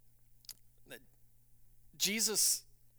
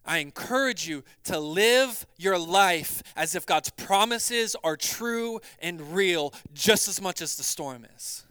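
usual speaking voice

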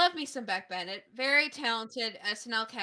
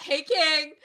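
loud playful greeting; 5 semitones higher, 10 dB louder than usual. Real, not acted.

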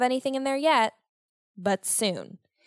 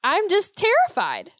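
laughing; 9 semitones higher, 5 dB louder than usual. Real, not acted. Laughing at own paranoia.

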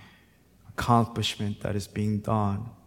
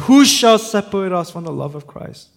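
loud, high declamation; impassioned preacher reading scripture aloud; forceful scripture preaching; 11 semitones higher, 13 dB louder than usual. Real, not acted. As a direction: fling the question out to the congregation.

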